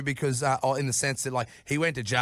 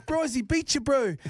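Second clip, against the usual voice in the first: put-on pitched-up Kiwi-accent heckle from the back; 13 semitones higher than usual. Planned Kiwi-accent heckle at Hemo from the dark. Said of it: "with a Kiwi accent".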